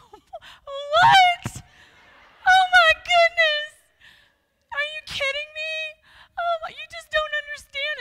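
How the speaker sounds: high, loud wailing half-sob; crying voice. Retold or imitated. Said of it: "then you get the crying voice".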